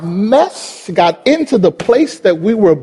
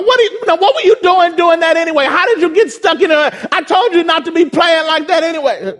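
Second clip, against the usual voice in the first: high, scolding father voice; chastising dad; 5 semitones higher, faster than usual. Retold or imitated. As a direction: scold the kid stuck in the bars. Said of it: "he tries to chastise his son".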